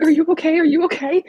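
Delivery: high, loud, urgent mock panic; alarmed boyfriend. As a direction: act out a boyfriend fearing the worst. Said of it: "He thinks I'm being, like, mugged".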